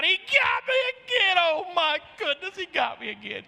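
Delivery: very high, loud mock scream, easing down by the end; imitated drunken scream. Drunk Raul hollering during preaching, imitated scream. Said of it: "he'd scream out".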